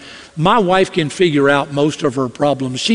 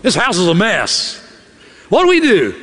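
exasperated wife; 4 semitones higher than usual. Retold or imitated. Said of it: "A woman will say this".

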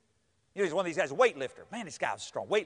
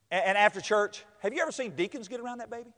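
ordinary talk

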